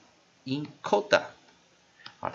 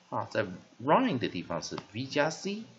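ordinary talk

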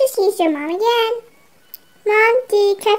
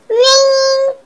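high loud ring like a telephone; mock phone ring; 6 semitones higher, 6 dB louder than usual.

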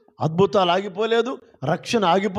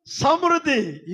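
ordinary delivery

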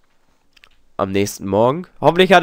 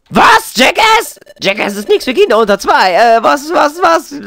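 shocked character voice; 10 semitones higher, 4 dB louder than usual. Acted. Voicing shocked game character.

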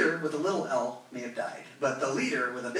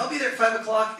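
louder flaky promise; 5 semitones higher, 6 dB louder than usual. Retold or imitated. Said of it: "people are like".

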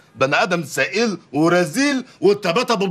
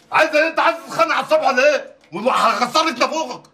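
high-pitched, rattled threat; angry bickering; 8 semitones higher than usual. Acted. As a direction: pick a fight with your wife, voice raised high. Husband squabbling, threatening his wife. (خناقة بصوت عالي)

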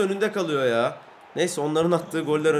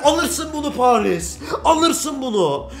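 slow, higher triumphant taunt; gloating taunt; 6 semitones higher, slower than usual. Real, not acted. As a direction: rub it in to the opponent. Taunting Paris after goal.

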